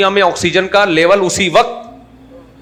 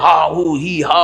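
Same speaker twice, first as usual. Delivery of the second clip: slow, drawn-out pulpit noises, half-hearted; mock ranting preacher; slower than usual. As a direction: imitate a preacher bellowing, without real volume. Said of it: "چیخ رہے ہوتے ہیں". (جوشیلے مولانا کی ہلکی سی نقل)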